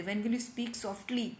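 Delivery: calm, quiet classroom example; soft demo. Shown as a demonstration. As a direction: say it gently as a hushing reminder. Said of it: "धीरे से बोलो".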